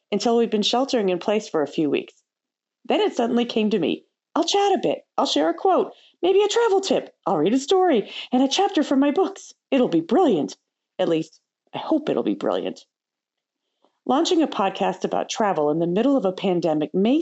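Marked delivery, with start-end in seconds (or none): 4.3-10.6 mild excitement, higher through the list; excited idea. Retold or imitated. Telling herself the sudden podcast idea, mildly excited. 11.0-12.9 lower, hedging aside; hesitant hope. Retold or imitated. Podcaster undercutting her own big plan, hesitantly hopeful. Backs off her confident claim.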